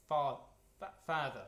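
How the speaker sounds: "father" in a Kiwi accent, the vowel fronted toward "feather". Shown as a demonstration. To the choir, contrasting with the Kiwi way: Kiwi accent "father". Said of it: "the more Kiwi way of pronouncing it".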